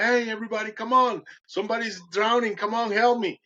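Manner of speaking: rally everybody to help; retold urgent shout for help; calling out. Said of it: "he started calling".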